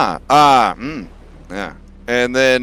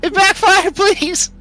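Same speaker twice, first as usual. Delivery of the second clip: high, loud pained wailing; whiny dragon yelling; 10 semitones higher, 6 dB louder than usual. Acted. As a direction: wail for help, stuck. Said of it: "And Kyra goes".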